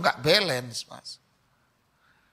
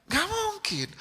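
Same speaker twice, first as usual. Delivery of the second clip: "Nggak mungkin" jumped up much higher, mocking disbelief; incredulous no; 3 dB quieter, slower than usual. Shown as a demonstration. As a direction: toss "Nggak mungkin" up high like it's absurd. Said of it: "Saya bilang". ("Nggak mungkin" diucapkan jauh lebih tinggi, tak percaya)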